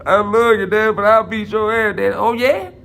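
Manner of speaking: hammed-up high pleading kid; whiny son voice. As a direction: beg daddy not to shoot. Son voice begging father.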